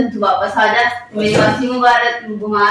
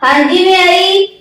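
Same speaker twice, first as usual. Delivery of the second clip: sing-song reply; 7 semitones higher, 5 dB louder, slower than usual. Acted. Sweet slow reply to husband.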